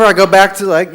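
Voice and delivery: normal talking voice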